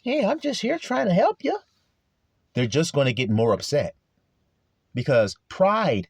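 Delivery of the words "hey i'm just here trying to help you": high, put-on sweet helper voice; mocking a naive helper, much higher; mock helpful plea. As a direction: play the hurt do-gooder, pitched up. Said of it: "it's not gonna profit you to say".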